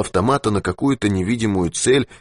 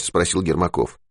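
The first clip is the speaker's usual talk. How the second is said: ordinary delivery, nothing marked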